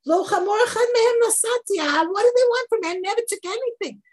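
high, loud, fast protest; indignant Moshe. Acted. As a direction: throw up your hands in protest.

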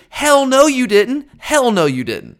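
high, loud indignant denial. Real, not acted. Fan rejecting the playoff committee's logic, indignant. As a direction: throw it back in disbelief, pitched high.

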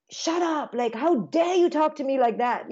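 high, indignant mock scolding; imitating a parent losing it; mock angry parent. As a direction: play the offended parent, hammed-up. Parent voiced snapping back.

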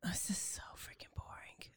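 very soft, voiceless aside; whispered complaint. Real, not acted. Listener heckling about a list of affair rules, whispered. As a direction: whisper it under the storyteller's account.

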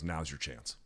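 hushed voice in the head; low, softened prompt to oneself, as if imagined; quiet nudge from within; inner voice. Retold or imitated. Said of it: "Some voice in my head just goes".

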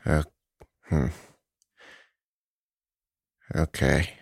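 ordinary talk, nothing marked

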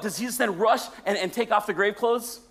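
ordinary talk, nothing marked

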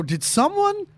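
high, swooping disbelief; incredulous questioning. Retold or imitated. Boyfriend asking girlfriend about her past, incredulous. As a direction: question them with exaggerated high-pitched bafflement.

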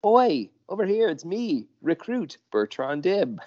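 put-on high, eager young recruit calling out. Recruit calling to his boss, high and eager. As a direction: pipe up like a keen rookie.

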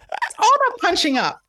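high, mock-grand comic voice, repeating the joke; mock comedian voice. Repeating the punchline to his cohost in a mock comedian voice. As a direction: ham it up like a smug stand-up.

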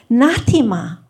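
gasp of recognition. Retold or imitated. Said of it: "I actually gasp out loud".

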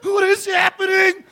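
high-pitched mock wailing child; shrieky kid meltdown, played for laughs; distraught kids. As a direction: wail it like a horrified kid, hammed-up. Kids' horrified reaction, voiced mockingly.

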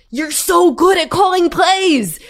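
exasperated. Real, not acted. Venting frustration at the coach.

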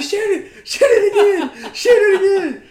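very high, loud, repeated yell; frantic retold shouting. Uncle yelling at boy over wounded raccoon, frantic. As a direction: imitate a panicked uncle yelling orders.

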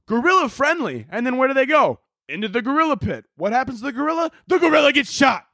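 high-pitched comic rant building to a shout; worked-up rant. Acted. Comic retelling kids' zoo mishap, worked-up and high. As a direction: fire off question and answer, rising to a yell. Ranting mock exchange about zoo tragedy.

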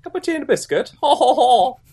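high giggly posh English caricature; mock posh English. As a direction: parody a tea-loving English toff. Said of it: "We don't actually speak like that".

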